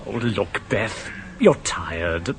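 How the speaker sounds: soothing. Acted. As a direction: talk her down gently. Husband calming his wife.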